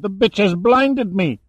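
high, loud, outraged wail; agonized cry. A narrator's reading. Man just blinded by his captive, crying out. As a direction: cry out high in pain and shock. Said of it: "Julio cried".

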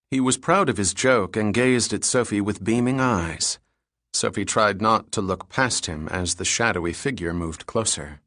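usual speaking voice

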